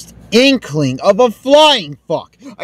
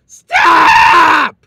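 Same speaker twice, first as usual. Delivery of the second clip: furious shout; 8 semitones higher, much slower than usual. Real, not acted. Gamer yelling at the game to stop, furious shout. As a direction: scream it at the screen.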